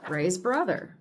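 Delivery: ordinary delivery, nothing marked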